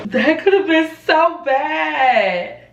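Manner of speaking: high, loud, slow shocked exclaim; reacting to a near disaster; loud stunned disbelief; alarmed exclaim. Real, not acted. Laptop nearly fell.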